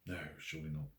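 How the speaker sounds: usual speaking voice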